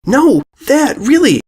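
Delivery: put-on high urgent girl voice. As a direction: insist desperately. Filly insists.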